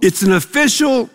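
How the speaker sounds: ordinary delivery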